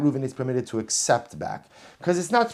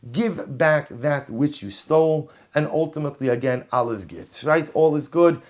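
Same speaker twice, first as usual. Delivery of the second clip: ordinary talk, nothing marked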